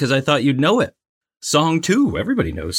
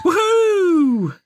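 much higher joyful whoop; cheer. Real, not acted. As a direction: whoop like you just scored. Cheering a correct answer.

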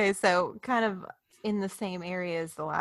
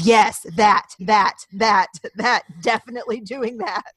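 sarcastic; 3 semitones higher, 8 dB louder than usual. Retold or imitated. Jim's sarcastic agreement.

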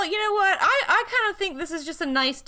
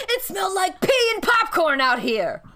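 laughing shout; 6 semitones higher, 4 dB louder than usual. Real, not acted. Laughing shouts about popcorn.

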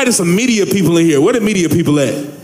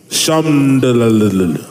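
mock prayer phrase; 8 semitones lower, much slower than usual.

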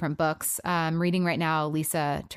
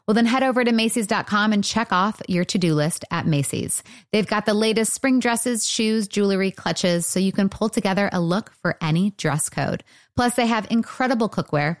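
louder, upbeat podcast ad read; bright host-read commercial, punchy and louder; 5 dB louder than usual. Acted. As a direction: sell it like a sponsor spot. Host reads sponsor ads.

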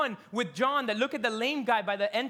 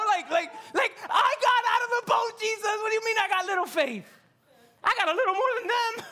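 high, loud protest breaking into laughs; laughing indignant rant; 13 semitones higher, 6 dB louder than usual. Pretend. Pastor playing Peter arguing with Jesus, laughing shout. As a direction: shout the complaint while cracking up. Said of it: "I would be like".

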